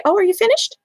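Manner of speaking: put-on cheery high-pitched parent asking a toddler; bright check-in. Shown as a demonstration. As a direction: ask it gently and brightly, way up high. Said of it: "take your hand away and say".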